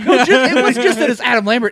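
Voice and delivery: loud laughing protest. Real, not acted. Laughing denial of the teasing.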